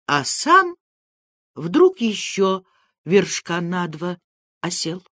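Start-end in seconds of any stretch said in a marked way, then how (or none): none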